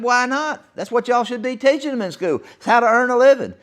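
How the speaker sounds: a hint of an indignant old grandmother; granny sticking up for the boys' business; granny's retort. Granny retold defending the boys.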